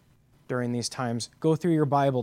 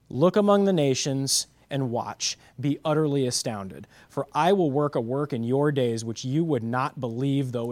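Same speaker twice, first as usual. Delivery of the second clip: louder, measured preacher delivery; emphatic scripture reading; 6 dB louder than usual. Acted. Pastor proclaiming God's answer to his church, loud and steady.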